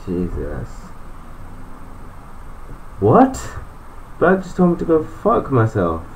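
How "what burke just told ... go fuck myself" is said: high, stunned outburst; a high, indignant what; shocked disbelief. Real, not acted. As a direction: jumps high in outrage. Shocked at Burke's insult.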